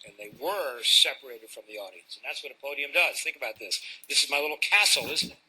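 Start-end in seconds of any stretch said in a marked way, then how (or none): none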